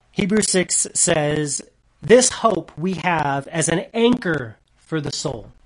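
loud, driving preacher's reading; preaching at full force. Acted. Pastor reading Hebrews to the congregation, preaching loudly. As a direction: proclaim the scripture to the back row.

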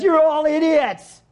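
high, slow mock heckle; preacher imagining a heckler shouting down the sermon; heckler's outburst. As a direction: blurt it like a rude interrupter. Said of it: "Norm stands up and says".

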